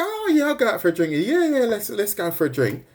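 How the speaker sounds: high, fast, overeager yes; mock eager acceptance. High mock eager Wendy accepting the drink invite. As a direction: chirp it like a keen yes. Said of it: "you go".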